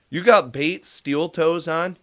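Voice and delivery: normal talking voice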